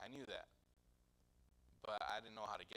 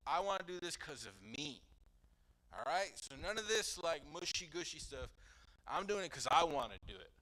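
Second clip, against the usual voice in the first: higher, louder and fast, getting worked up; heated; 6 semitones higher, 7 dB louder than usual. Retold or imitated. Lecturing his mom, heated and fast. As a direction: insist it hotly, pushing each point. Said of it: "I'm getting all heated it up".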